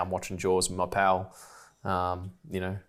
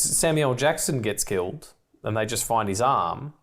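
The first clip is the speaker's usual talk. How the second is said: usual speaking voice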